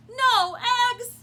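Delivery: loud, high outcry; farmer's loud cry of dismay. A narrator's reading. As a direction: shout it in dismay. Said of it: "cried Farmer Brown".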